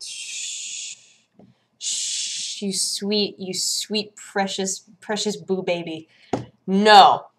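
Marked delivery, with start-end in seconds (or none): 0.0-6.1 put-on soft shushing baby talk; mock cooing. Cooing at the game key like a baby, soft baby talk. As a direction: hush it like soothing a baby.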